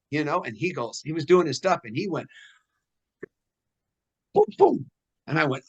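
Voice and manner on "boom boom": mock strike sounds. Imitating Parker's strikes.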